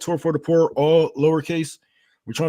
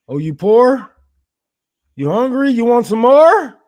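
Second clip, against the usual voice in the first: mocking taunt; 7 semitones higher, 8 dB louder than usual. Mocking a broke viewer.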